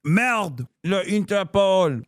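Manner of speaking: hammed-up French accent; french chef. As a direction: mutter it like a cartoon Frenchman. Mock French words in a French voice.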